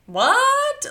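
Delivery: high, loud incredulous what; shocked reaction. Retold or imitated. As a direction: imitate someone utterly taken aback. Dorit's retold incredulous reaction.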